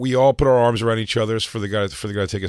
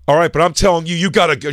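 louder, higher scolding order; retold yelling; 7 semitones higher, 6 dB louder than usual. Security lady telling fans to go, imitated yelling. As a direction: imitate a staffer yelling at patrons. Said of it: "She's, like, yelling at".

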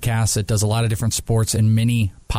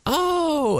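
high startled oh; a caught-off-guard oh, pitched way up; surprised oh. Retold or imitated.

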